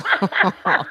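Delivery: high cackling villain laugh; a gleeful wicked cackle; evil laugh. Real, not acted. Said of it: "esta risa, mm, maléfica".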